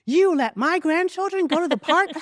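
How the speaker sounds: high, loud, shocked mother voice; alarmed grandmother. Retold or imitated. Mother on the phone horrified the kids are alone, high shocked cry.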